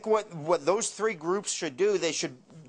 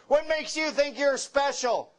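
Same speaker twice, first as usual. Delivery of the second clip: mock angry cop; 7 semitones higher than usual. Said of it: "He started to get mad".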